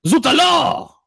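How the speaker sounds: high, hammed-up French exclamation; mock French accent. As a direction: squawk it like a cartoon Frenchman. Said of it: "The French guy would".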